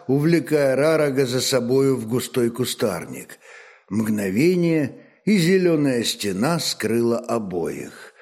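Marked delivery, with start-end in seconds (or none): none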